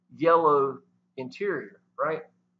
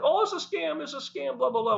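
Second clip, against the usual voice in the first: much higher, sing-song mock of doubters; mocking the haters; 11 semitones higher than usual. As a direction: parody the naysayers' whine. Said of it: "the haters loved it 'cause it's like".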